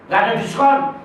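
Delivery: high, loud flat no; firm refusal. Retold or imitated. A teacher refusing pupils' bargaining, loud and firm. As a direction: shut the bargaining down.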